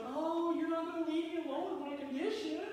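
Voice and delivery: high, put-on whining voice; guilt-trip whine. Husband whining to his wife so she won't go out, high and pleading.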